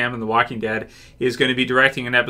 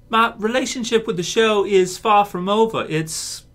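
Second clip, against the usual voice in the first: put-on higher-pitched amateur English accent, halting at the end; English accent impression; 8 semitones higher, slower than usual. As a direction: attempt an English actor's accent, slowing on the last words. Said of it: "my impression? It's a horrible impression".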